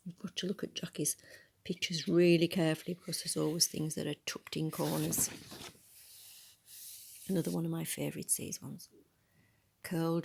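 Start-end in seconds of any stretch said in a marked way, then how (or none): none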